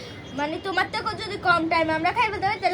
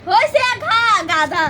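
teasing shout; 2 semitones higher, 7 dB louder, slower than usual. Real, not acted.

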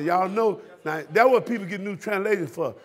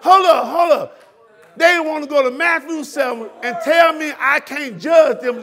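fired-up shout; 5 semitones higher, 7 dB louder than usual. Acted. Interrupting himself, fired up.

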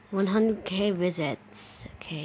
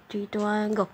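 normal talking voice

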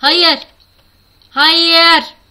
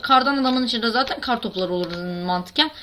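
normal talking voice

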